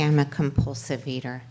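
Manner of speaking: usual speaking voice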